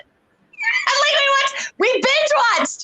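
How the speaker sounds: squealing how hooked they got. Real, not acted. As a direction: pitch jumps way up with excitement. Excited about bingeing whole show.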